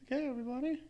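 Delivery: high, silly clown greeting; squeaky goofy clown host. Pretend. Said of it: "That's my clown voice".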